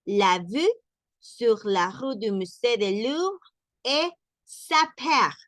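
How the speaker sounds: slow French, lips held round; French sentence. Shown as a demonstration. Teacher repeating the example slowly.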